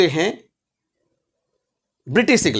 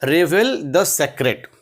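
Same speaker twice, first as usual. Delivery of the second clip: slow, careful and falling; slow model phrase; 3 semitones lower, much slower than usual. Shown as a demonstration. Teacher slowly models English for Hindi learners. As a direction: close the drill slowly. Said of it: "teacher models English phrase".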